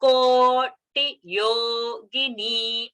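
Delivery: "Koti Yogini" very slow, long ko, short ti, long yo, short gi, long ni; syllable-by-syllable demo of "Koti Yogini" vowel lengths; alternating long and short. Shown as a demonstration. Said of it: "rasva dirgha combination".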